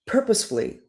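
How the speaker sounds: ordinary delivery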